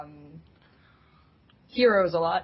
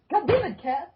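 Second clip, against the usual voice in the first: startled outburst; 9 semitones higher than usual. Real, not acted. Cat interrupts her.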